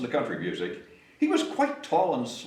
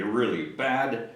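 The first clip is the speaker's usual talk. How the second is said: usual speaking voice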